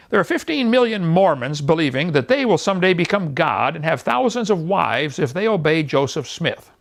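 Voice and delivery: usual speaking voice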